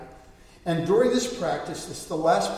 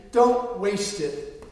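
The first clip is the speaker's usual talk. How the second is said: normal talking voice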